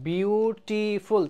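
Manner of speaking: বিউটিফুল slowly divided into বিউ-টি-ফুল; বিউটিফুল sounded out slowly, each syllable on its own. Shown as a demonstration. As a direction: say বিউটিফুল in three drawn-out beats. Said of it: "তিনবার উচ্চারণ".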